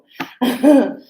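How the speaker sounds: short amused laugh; real brief chuckle; light laugh. Real, not acted. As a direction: laugh at your own joke. Laughs at own plea.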